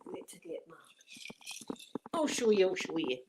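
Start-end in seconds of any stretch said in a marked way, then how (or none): 0.1-0.7 whisper. Real, not acted. Quiet aside while fetching something.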